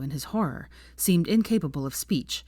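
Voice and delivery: plain reading voice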